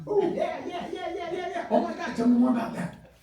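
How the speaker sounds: high, bubbly, laughing oh yeah yeahs; delighted phone chatter, high and laughing; eager phone listener. Shown as a demonstration. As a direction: gush like you're hooked on their story. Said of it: "I'm like".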